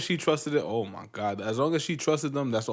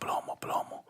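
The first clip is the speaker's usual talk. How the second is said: whisper; 10 dB quieter, faster than usual. Pretend. Repeated chant dropped to whisper.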